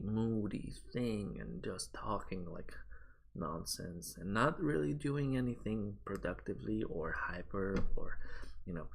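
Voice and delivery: hushed, low, drowsy murmur; low quiet voice. Shown as a demonstration. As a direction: keep it low and sleepy like an ASMR stream. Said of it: "I have to talk like this".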